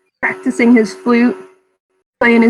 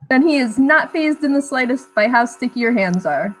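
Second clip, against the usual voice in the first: ordinary talk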